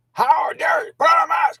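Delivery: high, loud mock shout at me; retold yell. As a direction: bellow it like the angry banker. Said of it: "yelled at me".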